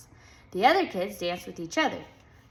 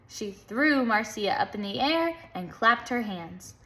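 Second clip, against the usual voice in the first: plain reading voice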